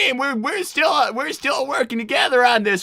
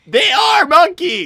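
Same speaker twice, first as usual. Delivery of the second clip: insistent shout; 6 semitones higher, 7 dB louder, slower than usual. Acted. Arguing over monkeys, in character.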